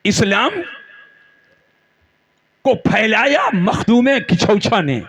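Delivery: refrain rising, each name answering it; rhythmic preaching. Real, not acted. Preacher lists saints who spread Islam, rhythmic rising refrain. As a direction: ring out a roll call with suspenseful pauses.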